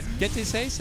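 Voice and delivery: "Veinte seis" said as veynteh seys in Spanish; "Veinte seis" with Spanish vowels, rising at the end. Shown as a demonstration. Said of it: "Can you say that in Spanish?".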